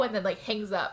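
normal talking voice

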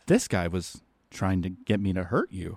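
a quiet murderous threat; murderous inflection. Retold or imitated. Zombie Piscopo turning on the bad guy, low and menacing. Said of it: "attempt at a murderous inflection".

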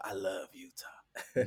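soft, slow whisper; slow hushed whisper of affection. Real, not acted. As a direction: breathe it fondly, slowly. Fond aside about Utah.

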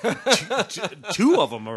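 laughing fast and loud. Real, not acted. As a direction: laugh through the words. Laughing about royal films.

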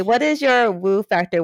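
ordinary talk, nothing marked

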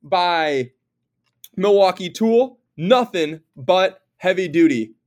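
slow, punched sponsor read; show announcer. Acted. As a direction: open the show big, stretching the key words.

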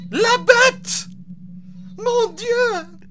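put-on high, shrill French girl in fright; frightened French girl. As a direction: gasp it out like a terrified child. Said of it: "she says".

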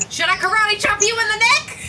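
high squeaky offer. Acted. Remy offering help, voice jumps.

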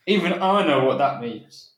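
ordinary talk, nothing marked

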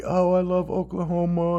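put-on heartfelt plea; mock sob story. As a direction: whine it in mockery of a coach's sob story. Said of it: "he gave this sob story".

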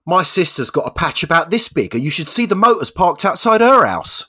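high, loud punchline delivery; little Johnny explaining with full conviction; boastful schoolboy. Acted. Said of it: "He goes".

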